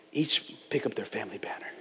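soft whispered quote; whispered scripture. Real, not acted. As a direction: hush it like a secret.

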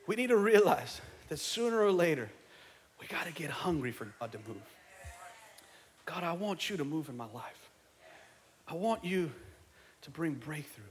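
soft, earnest plea; intimate, subdued preaching; hushed, prayerful. Real, not acted. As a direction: drop right down into a quiet appeal to God. Hushed prayerful appeal after shouting.

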